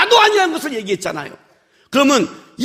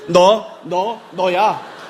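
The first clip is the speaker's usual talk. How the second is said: very slow, lower, one by one; slow singling out; 6 semitones lower, much slower than usual. Acted. Preacher singling out the guilty listener, slow and deliberate. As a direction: pick them out with drawn-out words. Acting out pointing at a congregant.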